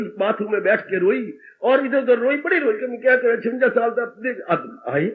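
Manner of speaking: ordinary delivery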